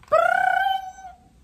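very high, loud ringing cry; a shrill imitated alarm bell. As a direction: ring out like an alarm clock. Imitating alarm clock ring.

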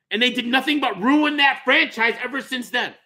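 usual speaking voice

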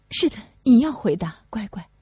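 put-on whispered gentle prompt to a child. Lucy whispering to her daughter to answer.

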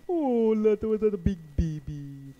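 high, loud, slow mocking voice; mocking dad. Dad teasing his son as a baby, high and loud. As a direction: sneer it out like a teasing father. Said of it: "That's what my dad said".

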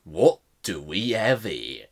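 menacing gravel-edged British drawl; slow, menacing villain greeting; Billy Butcher impression. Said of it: "that was my Billy Butcher impression".

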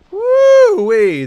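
high, very loud drawn-out whoop; excited whoop. Real, not acted. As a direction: let out a big high woo. Mock cheer during game.

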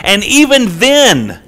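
high, loud, drawn-out pulpit emphasis; impassioned preaching. Real, not acted. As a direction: stretch it out as the turning point. Preaches at full force.

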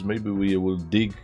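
ordinary talk

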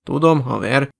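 plain reading voice

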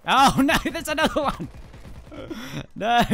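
high, loud laughing scream; laughing shriek. Real, not acted. Gamer spotting another enemy, laughing shriek. Enemy all-stars appear.

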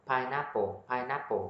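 "Pineapple" joined up as "pai-NA-po"; linked pronunciation. Shown as a demonstration. Teacher demonstrates linking for learners, n joined on. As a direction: run the n straight into the second half of "pineapple". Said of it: "Mình đọc nối vô".

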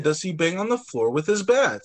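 ordinary delivery

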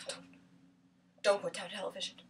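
low, very soft warning; hushed, controlled threat; quiet warning. Acted. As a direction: warn them under your breath. Quiet warning before outburst.

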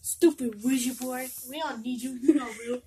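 taunting. Acted. Taunting the board.

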